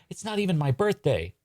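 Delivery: put-on high-pitched child's protest; protesting kid. Birthday kid interrupting the magician's proclamation, protesting.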